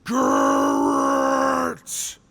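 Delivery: loud, high shout of the name; furious shout. Acted. Soldier realizing Garrett tricked them, angry shout. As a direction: yell it in sudden rage. Discovering the spy microphone.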